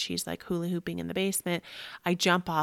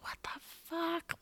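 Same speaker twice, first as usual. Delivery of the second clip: hushed, high whispered curse; stunned swearing; 11 semitones higher, 8 dB quieter than usual. Retold or imitated. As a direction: whisper it in pained disbelief. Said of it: "And I'm like".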